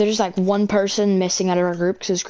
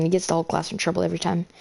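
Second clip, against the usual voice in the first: usual speaking voice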